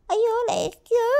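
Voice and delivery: normal talking voice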